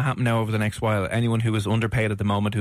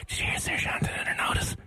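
whispering; 6 dB quieter than usual. Retold or imitated. Office gossip whispered.

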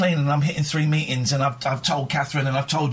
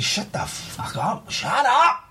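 retold shout; 8 semitones higher, slower than usual. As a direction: snap back at the voice in his head. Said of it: "I had to shout".

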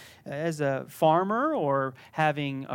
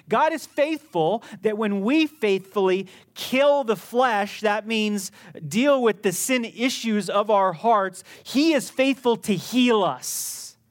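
louder, higher, slower proclamation; full-force preaching; 4 semitones higher, 6 dB louder, slower than usual. Real, not acted. Pastor proclaiming God's faithfulness to the church, fervent. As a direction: preach it, every word weighed.

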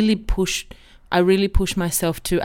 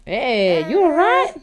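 pet voice; 5 semitones higher, slower than usual. Real, not acted. Aside to hungry co-host pet.

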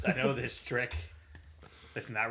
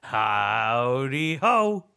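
loud, very slow howdy; drawn-out greeting; 4 semitones higher, 6 dB louder, much slower than usual. Acted. Greeting at the door.

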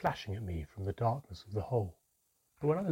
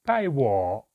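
loud, gruff dwarf voice; rough, unkind dwarf; rough dwarf grunt; 8 dB louder than usual. A narrator's reading. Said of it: "he grunted in a similarly rough voice".

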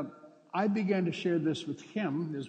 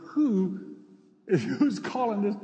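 shocked onlookers; 6 semitones higher, 6 dB louder than usual. Retold or imitated. Stunned inmates react to the insult.

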